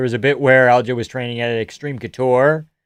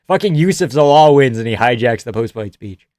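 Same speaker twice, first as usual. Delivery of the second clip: ordinary talk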